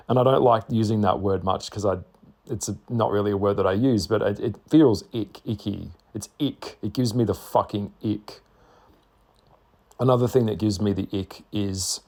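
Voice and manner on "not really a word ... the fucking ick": ordinary talk, nothing marked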